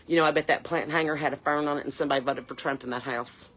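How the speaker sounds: low, soft, mock-pondering quote; mock musing, soft and low; mock stupid remark. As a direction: quietly imitate a silly suspicion, pitched low. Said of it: "You realize how stupid that sounds".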